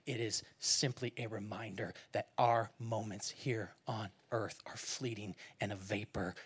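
low, soft and intimate, near a whisper in places; hushed Jesus voice. Acted. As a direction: speak as Jesus, quietly pleading. Jesus answering, voiced by preacher.

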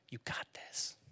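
whispered encouragement, soft and close; dad whispering encouragement to his son; tender whisper. Retold or imitated. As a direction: breathe it as reassurance. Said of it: "comfort him".

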